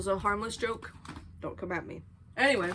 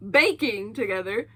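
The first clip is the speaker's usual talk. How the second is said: laughing through the words; 4 semitones higher, 7 dB louder than usual. Real, not acted. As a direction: giggle as you correct yourself.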